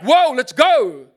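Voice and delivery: loud, slow, stretched whoop; fired up. Acted. Preacher voicing an empowered believer, loud excited whoop. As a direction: shout it like you're ready to go.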